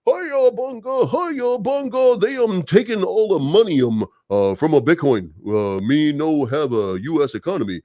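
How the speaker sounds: a cartoonish, hammed-up mock voice that stretches the words; mocking caricature voice. Vlogger mocking a politician in a very high, hammed-up caricature voice. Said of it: "Liz Warren's gonna say".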